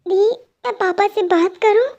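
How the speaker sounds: ordinary talk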